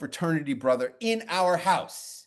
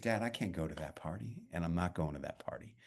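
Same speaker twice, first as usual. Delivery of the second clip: soft and flat, much softer, a firm "I'm not going"; quiet retold refusal; 12 semitones lower, 12 dB quieter than usual. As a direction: refuse Dad quietly, almost under the breath. Refusing his dad, retold.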